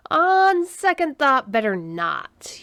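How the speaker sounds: slow, drawn-out reconsidering; said slowly. A narrator's reading. Human rethinks sharing his sandwich with alien, slowly. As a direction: stretch each word, starting high and sinking. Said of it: "human friend Mac said slowly".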